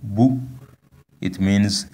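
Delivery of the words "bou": bou short and pitched high; bou, crisp high-toned syllable. Shown as a demonstration. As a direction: model bou on a raised tone. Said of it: "high tone on the vowel u".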